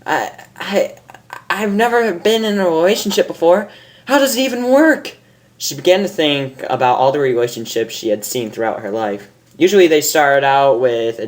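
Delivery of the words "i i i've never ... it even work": high, fretful and unsure; nervous. Acted. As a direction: stammer out her worry. Character frets aloud.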